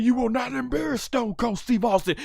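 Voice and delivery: imitating furious Stone Cold; mad Stone Cold. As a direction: seethe it, hammed-up. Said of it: "he said".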